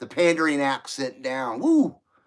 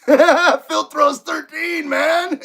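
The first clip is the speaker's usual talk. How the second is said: loud laughing Filtros thirteen, slow and high; cracking up mid gang shout; 10 semitones higher, 3 dB louder, much slower than usual. Acted. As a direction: yell it out through a laugh.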